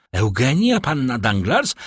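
usual speaking voice

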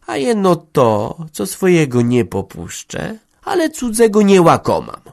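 slow, deliberate words rising at the end; slow, scornful reply. Acted. Hanka slowly refusing the schemer with scorn. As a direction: answer slowly, with contempt. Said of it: "odrzekła zwolna".

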